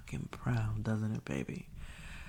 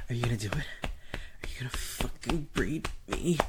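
whispering. Acted. Whispered roleplay.

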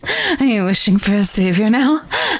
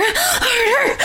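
peaking cry. Acted. Urging, rising to peak.

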